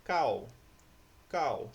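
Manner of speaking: loud, isolated "Cal", sounded as cau; "Cal" as cau, stronger on the A, gliding into U; syllable demonstration. Shown as a demonstration. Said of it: "Qual é o som mais forte?". ("Cal" isolado e forte, soando como cau)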